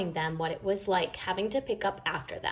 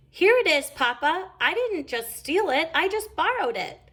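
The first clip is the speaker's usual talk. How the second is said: high, innocent little cub; 8 semitones higher than usual. A narrator's reading. Cub playing Sister returns Papa's paper, innocent. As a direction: hand it back sweetly.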